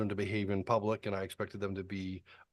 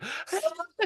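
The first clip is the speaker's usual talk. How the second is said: laughs. Real, not acted. Laughing at the memory.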